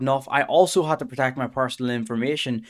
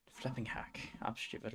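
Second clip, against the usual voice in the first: frustrated whisper; 18 dB quieter than usual. Real, not acted. Frustrated at a missed chess move.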